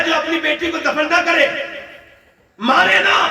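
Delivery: high, ringing declaration in spaced phrases; full-force preaching. Acted. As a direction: proclaim it to the crowd, falling at the close. (ऊँची आवाज़ में जोशीला एलान)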